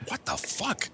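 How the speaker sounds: breathless whisper; whispered disbelief. Retold or imitated. Reacting to the party story, whispered. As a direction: whisper it in shock. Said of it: "I'm like".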